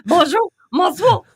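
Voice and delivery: wife mocking husband's mock-French, slow; husband's French attempt. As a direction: imitate the husband parroting French words. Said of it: "my husband go".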